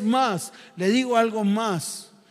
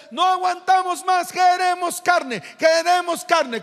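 demanding crowd; 9 semitones higher than usual. Acted. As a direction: chant the demand like a fed-up crowd. Israelites demand meat. (exigencia fuerte y lenta)

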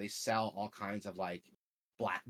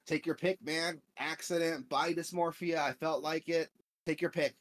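higher, louder, gleeful salesman voice; happy cyborg vendor; 8 semitones higher, 5 dB louder than usual. Retold or imitated. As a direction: pitch it up like a grinning chromed-out dealer. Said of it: "he's, like, so happy".

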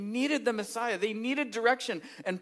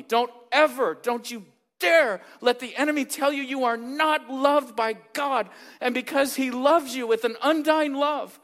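louder, higher fervent preaching; preaching at full force; 5 semitones higher, 7 dB louder than usual. Acted. Pastor urging his church that God loves them, fervent preaching. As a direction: drive it home to the congregation.

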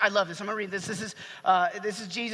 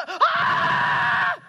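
high, loud mock scream of panic; hammed-up shriek of terror; terrified scream; 7 dB louder than usual. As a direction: scream like a panicked fan at a concert. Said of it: "started screaming".

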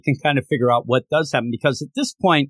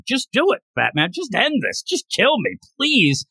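higher-pitched mock begging; retold pleading; 7 semitones higher than usual. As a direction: goad him, half pleading. Joker begging Batman to kill him.

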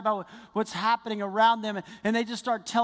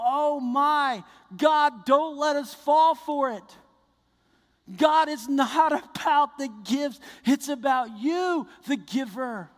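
raised, louder, slow plea to God; fervent prayer; 5 semitones higher, 4 dB louder, slower than usual. Acted. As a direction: cry out to God in drawn-out, impassioned prayer.

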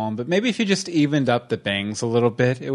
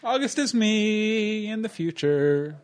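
mock Pinocchio voice; 8 semitones higher than usual. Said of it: "then he looks out and he's like".

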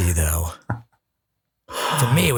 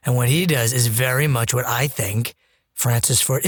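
ordinary delivery

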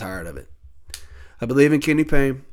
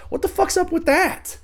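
outraged disbelief. Real, not acted. Outrage at Harden calling Morey liar.

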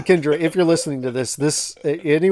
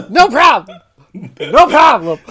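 higher and louder, breezy; mock cheerful shout; 8 semitones higher, 8 dB louder than usual. Joining the retold movie scene, loud breezy mock. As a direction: belt out a carefree brush-off. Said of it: "He goes".

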